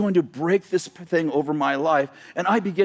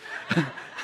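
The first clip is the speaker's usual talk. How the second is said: soft breathy laugh; 8 dB quieter than usual. Real, not acted. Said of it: "I get excited".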